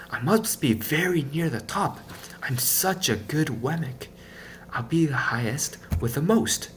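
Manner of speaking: lightly voiced self-congratulation; Punchinello's thoughts. A narrator's reading. Punchinello boasting to himself as he climbs.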